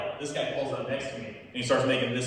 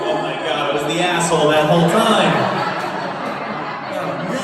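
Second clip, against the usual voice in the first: much louder horrified cry; a hammed-up howl of shame; horrified shout; 2 semitones higher, 12 dB louder than usual. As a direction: roar it as it dawns on him. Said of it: "second realization".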